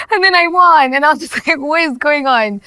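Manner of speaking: incredulous, laughing and loud; laughing disbelief. Real, not acted. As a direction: tell it through a burst of amazed laughter. Retells winning, laughing in disbelief.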